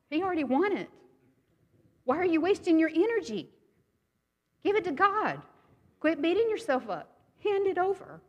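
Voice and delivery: Jesus urging her to stop fighting, voice climbing; urgent Jesus. Retold or imitated. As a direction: push her to let it go, rising urgency.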